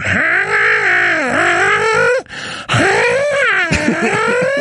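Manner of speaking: long, high cat wail; imitated cat yowl. Man imitating his cat that woke him, long yowl. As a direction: imitate a cat yowling, drawn out. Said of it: "I hear my cat going".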